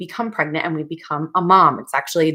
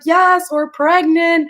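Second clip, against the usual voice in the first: high, slow, thrilled husband; excited husband; 11 semitones higher, 4 dB louder, slower than usual. Retold or imitated. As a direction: mimic the overjoyed husband. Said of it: "my husband being so excited".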